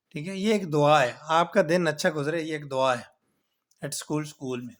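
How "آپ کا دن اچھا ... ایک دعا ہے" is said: normal talking voice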